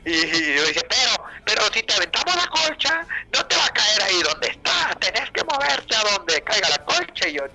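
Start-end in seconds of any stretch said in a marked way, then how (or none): 0.9-7.3 amigo explicando. Retold or imitated.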